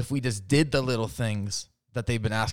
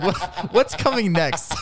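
high, laughing mock fear; husband suspects a trap, laughing mock fear; mock terrified; 8 semitones higher, 5 dB louder, slower than usual. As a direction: pretend to dread what comes next, laughing. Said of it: "I'm terrified as to what's coming".

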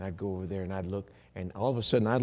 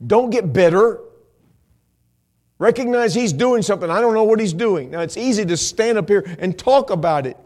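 high, loud pulpit exhortation; preach; 11 semitones higher, 14 dB louder than usual. Real, not acted. Preacher urging congregation to trust what He's doing, full-force preaching. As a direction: urge them at full force.

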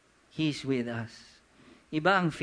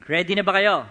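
calling out; 3 semitones higher, 9 dB louder, faster than usual. Real, not acted. Preacher checking the congregation is ready, calling out loudly. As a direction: rouse the congregation with a big shout.

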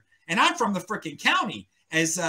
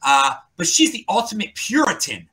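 normal talking voice

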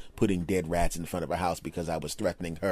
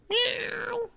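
very high, mock cat meow. Driving away, mimicking his ex's dead cat: high meow. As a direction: meow it like the old cat would. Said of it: "that's what the cat would have said".

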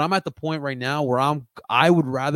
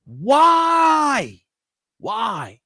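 much higher, louder, very slow outraged shout; exasperated; 9 semitones higher, 5 dB louder than usual. Real, not acted. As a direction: drag out the why in disbelief. Ranting about the qualifying offer.

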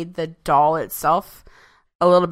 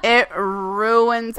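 ordinary talk, nothing marked

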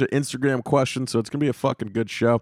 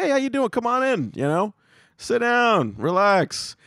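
much higher overly friendly greeting; polite barber; 8 semitones higher than usual. Retold or imitated. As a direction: greet a customer sweetly. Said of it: "be all polite and I'd say".

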